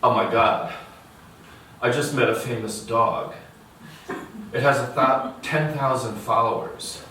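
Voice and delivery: lightly voiced excited girl on a call; a hint of a gushing phone caller; girl on her phone. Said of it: "The girl at the next bench is on her phone".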